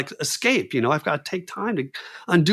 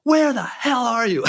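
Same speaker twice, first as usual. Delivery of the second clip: mock angry yell; 9 semitones higher, slower than usual. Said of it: "He's even more pissed off".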